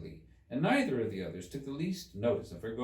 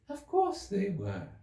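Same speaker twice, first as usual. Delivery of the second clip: high, slow little Dormouse; 10 semitones higher, slower than usual. Acted. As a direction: answer slowly in a high small voice.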